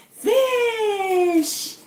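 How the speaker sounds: high, long-drawn market cries, falling away; imitated screaming vendors. Market ladies screaming their wares to shoppers, imitated cry. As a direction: stretch each word like a mock vendor's call. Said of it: "hear all these ladies already screaming".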